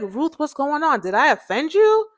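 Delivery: retold question, pitched high; pitchy, cracky voice. Asking crying friend what's wrong, pitchy. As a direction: imitate your own voice going pitchy. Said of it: "my voice was pitchy and cracky".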